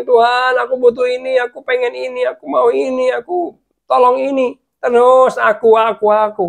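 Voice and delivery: mock pleading, high and insistent; demanding prayer. Mimics demanding prayer, against soft one.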